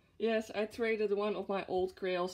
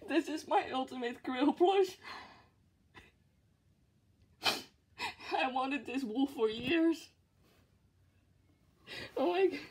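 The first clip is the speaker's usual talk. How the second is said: high, tearful, overwhelmed voice; real happy tears welling up; on the verge of tears; 5 semitones higher than usual. Real, not acted. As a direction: choke up while apologizing and gushing. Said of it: "I'm gonna cry".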